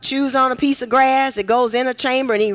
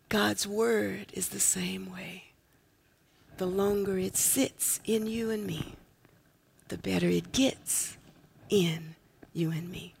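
hushed whisper, much softer and lower; 7 semitones lower, 13 dB quieter than usual. Real, not acted. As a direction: lean in and whisper it to them. Preacher drops to whisper.

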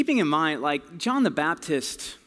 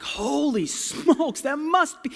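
high, slow, drawn-out awe; amazed; 10 semitones higher, slower than usual. Retold or imitated. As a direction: gasp it out in wonder. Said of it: "John the Baptist is like".

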